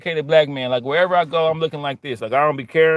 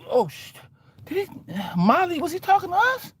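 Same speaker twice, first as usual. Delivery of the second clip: high, nervous, hushed question; startled onlooker; 13 semitones higher than usual. Retold or imitated. Woman asks Molly if he spoke to them, high and nervous. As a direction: ask your friend in alarm.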